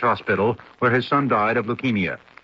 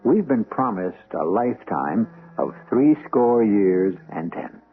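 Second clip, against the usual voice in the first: slow, low, hushed invitation to a ghost story; mystery host welcome; 4 semitones lower, slower than usual. Acted. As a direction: draw the listener in like a spooky host. Radio mystery host opening.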